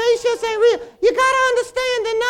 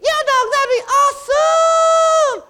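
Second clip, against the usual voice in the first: loud, slow, thrilled cry of delight; bursting with excitement at the idea; excited shout; 7 semitones higher, 7 dB louder, slower than usual. Acted. To his friend about a real spaceship, a thrilled shout.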